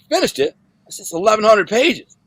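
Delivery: high-pitched incredulous echo; acted amazement, pitch shooting up; retold disbelief. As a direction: question it in astonishment, voice jumping up. Retelling own disbelief at reader.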